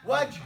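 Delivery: Waj with a slight push of air at the end; breathy Waj. Shown as a demonstration. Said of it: "just let the air out".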